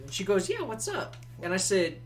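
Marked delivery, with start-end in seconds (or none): 0.5-1.2 put-on casual girl voice. Voices Sammy in retold bar scene.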